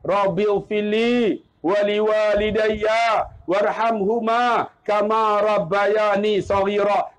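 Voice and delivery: slow, drawn-out Arabic supplication; prayer recitation. Shown as a demonstration. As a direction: recite slowly, stretching the long vowels. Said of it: "Apa doa dia?". (bacaan doa perlahan dan meleret)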